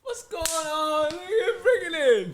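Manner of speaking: high, drawn-out, laughing welcome; exuberant familiar greeting. Retold or imitated. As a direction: imitate greeting an old friend with a hug.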